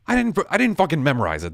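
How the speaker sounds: high, loud, fast protest; exasperated, rushed outburst. Real, not acted. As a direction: snap back defensively, voice jumping up. Flustered defence of his recall.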